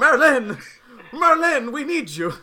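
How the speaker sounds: high, loud call for Merlin; urgent in-character holler. Acted. Calling Merlin through the magic box.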